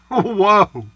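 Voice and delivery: high laughing whoa; surprised whoa. Real, not acted. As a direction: react with surprised delight. Reacts to gas price.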